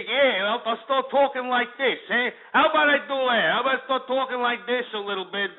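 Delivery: brash mock Jersey Shore tough-guy accent; hammed-up Jersey Shore guido talk, punctuated with "eh?". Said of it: "me acting like some, uh, cannoli-eating wop".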